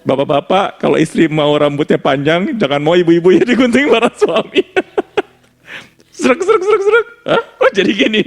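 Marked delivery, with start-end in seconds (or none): 2.6-4.7 high, louder teasing through laughter; laughing warning. Real, not acted. 6.2-7.1 very high srek srek sound; scissor snips. Retold or imitated. Imitating scissors cutting hair. 7.2-8.2 shocked question. Retold or imitated. Wife shocked at haircut.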